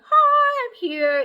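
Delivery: very high, bright chirpy greeting; imitating the friend's giddy high hello; bubbly Tanya impression. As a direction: burst in like a ray of sunshine.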